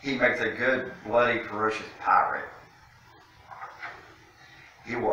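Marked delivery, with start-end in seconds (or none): none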